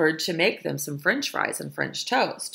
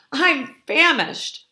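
ordinary delivery

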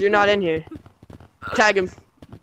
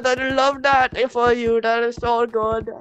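put-on Indian accent.

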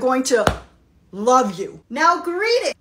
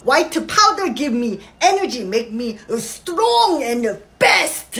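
put-on Korean mom accent; slower than usual. Mother explains in broken English.

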